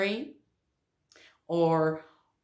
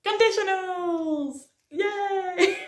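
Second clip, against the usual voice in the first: high-pitched, drawn-out mock celebration; over-the-top sarcastic yay; sarcastic cheer; 11 semitones higher, slower than usual. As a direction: cheer it with fake glee, stretching the endings. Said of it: "That was sarcasm".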